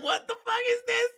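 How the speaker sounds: high incredulous mock cry. Reacting to the movie's dialogue, high disbelief. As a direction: squeal it in disbelief. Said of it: "I was like".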